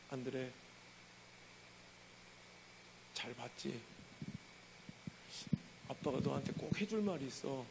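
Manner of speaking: soft, low fatherly voice; father gently calling his crying son; gentle father. Retold or imitated. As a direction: speak softly, like a father comforting. Father speaks after long silence.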